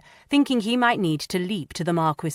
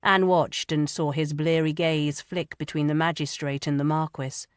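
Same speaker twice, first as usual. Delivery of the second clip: ordinary delivery, nothing marked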